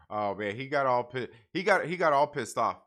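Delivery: ordinary delivery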